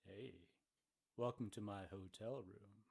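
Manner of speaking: low, soft, creepy welcome; a creepy come-on, played as a joke; mock creepy. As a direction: pretend to be creepy, low and hushed. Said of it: "if you're thoroughly creeped out".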